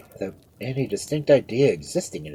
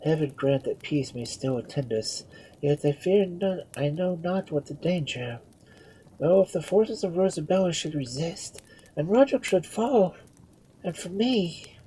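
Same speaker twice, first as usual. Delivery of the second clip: higher-pitched, uneasy reverie; anxious musing; 8 semitones higher than usual. A narrator's reading. Queen fearing for Roderick, anxious murmur. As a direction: muse anxiously, trailing into dread. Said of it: "with an air of intense anxiety".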